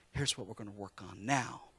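gentle Jesus. Retold or imitated. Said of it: "he looks at you, and he loves you".

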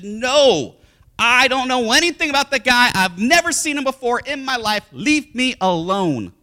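loud, high angry denial. Acted. Peter cursing the accusers, loud angry denial. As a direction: push them away. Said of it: "and says".

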